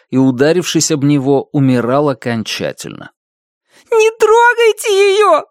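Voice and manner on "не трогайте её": tearful high scream; angry crying. Acted. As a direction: cry out through tears. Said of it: "сквозь слёзы прокричала Ада".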